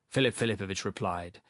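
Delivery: ordinary delivery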